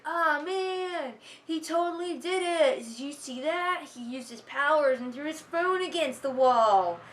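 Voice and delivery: high, put-on dude voice; mock dumb bullies. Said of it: "and then they're like".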